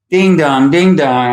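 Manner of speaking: playful doorbell call; imitating a chime. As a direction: mimic the laptop ringing. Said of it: "a month or two ago".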